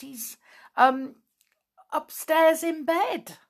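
halting, high and hesitant; stammering. A narrator's reading. Flip admits where Binkle is, stammering. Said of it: "he stammered at last".

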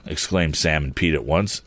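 plain reading voice

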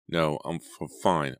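usual speaking voice

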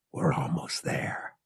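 soft unvoiced whisper; hushed private hope, whispered; whispered thought. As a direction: breathe it out under your breath. Han's hushed inner thought.

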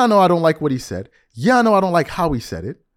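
ordinary delivery

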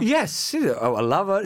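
our lover in a sultry, drawn-out Italian accent; sexy Italian accent. Shown as a demonstration. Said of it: "that is not a sexy Italian accent".

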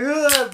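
a loud, high, half-laughing "ugh"; loud high groan. Retold or imitated. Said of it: "we're just laughing, like".

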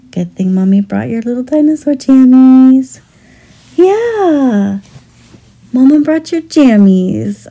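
put-on gentle soothing mommy voice to a toddler. Mother dressing sleepy toddler.

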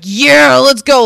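loud, pumped-up cheer; eager shout. Retold or imitated. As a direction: shout it like he's going for it. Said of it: "the person who was driving the Sybian was like".

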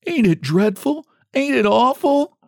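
high, distressed wail; sobbing. A narrator's reading. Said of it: "sobbed she".

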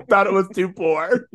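laughing repeat. Real, not acted. Repeating the joke amid laughter.

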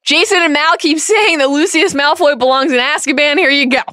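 high, loud smug handover; gleeful mock Dumbledore. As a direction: hand him over with a flourish. Dumbledore delivering Malfoy.

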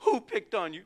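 hammed-up concerned mom voice; doting mom. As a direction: fuss protectively over a picked-on child. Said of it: "Mom would have done that".

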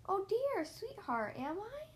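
high, playful mock surprise, smiling; sly smile. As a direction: pretend to be caught out, slyly. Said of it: "Missus Honeybunch smiled slyly".

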